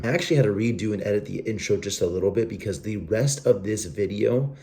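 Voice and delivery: ordinary delivery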